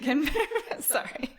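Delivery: giggling through the words; laughing. Real, not acted. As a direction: talk while laughing. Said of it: "I like to spontaneously laugh".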